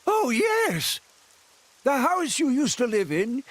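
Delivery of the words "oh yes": sudden realization. Acted. Villager suddenly remembering.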